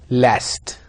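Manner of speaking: American "last" with a flat short a, /læst/; General American. Shown as a demonstration. Teacher modelling American "last" for the class.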